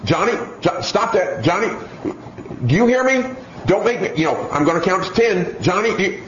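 high, fretful parent repeating herself; nagging parent. Retold or imitated. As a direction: whine the empty threats like a pushover mom. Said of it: "those parents that say".

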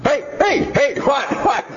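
high, yelped protest; put-on child voice, alarmed; startled kid. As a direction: protest as the game is interrupted. Child startled, game taken away.

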